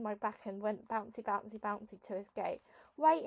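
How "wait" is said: a high, loud yell. A narrator's reading. Boy yelling after the girl to stop, high and loud. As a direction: shout it after her, sudden. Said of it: "he yelled".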